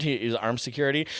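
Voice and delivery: ordinary delivery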